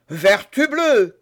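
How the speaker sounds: loud, slow, drawn-out curse; tangled musketeer swearing angrily; angry oath. A narrator's reading. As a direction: bellow the oath in indignation. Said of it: "cria Porthos".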